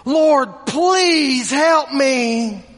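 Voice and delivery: a mother pleading with the king to help her; begging plea. Acted.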